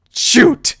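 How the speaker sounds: loud, whispery exclamation; short burst of breathy exasperation; mock frustration. Pony missing the train, mock exasperated. Train has left without him.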